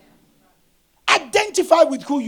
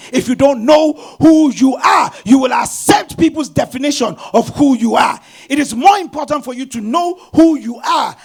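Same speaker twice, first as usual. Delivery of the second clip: preaching shout; 7 dB louder than usual. Real, not acted. Preacher shouting the repeated line at the congregation. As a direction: raise it to a full preaching shout.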